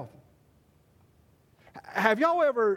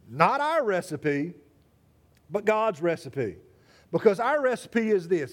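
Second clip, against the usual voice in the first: ordinary talk, nothing marked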